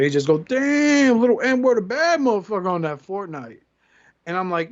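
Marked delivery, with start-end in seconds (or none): 0.5-3.6 high, loud mock amazement; imitated impressed friend. Friend marvelling at the boy's Fortnite skill, high excited imitation. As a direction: hype it up, voicing an awed friend.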